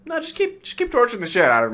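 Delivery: high, offhand mock M; lightly voiced M, breezy; mock casual order. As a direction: toss it off like it's nothing. M saying keep torturing him.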